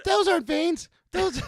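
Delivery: high shouted protest; mock indignant wife. Wife insisting to her husband, shouted high. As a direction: defend the cookies in a shrill-pitched yell. Acting wife defending cookies.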